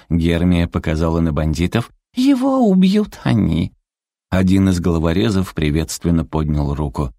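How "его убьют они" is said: very high, sweet, slow menace; cutesy creature villain. A narrator's reading. Зверёк мило показывает на бандитов. (милый писклявый голосок, неторопливо)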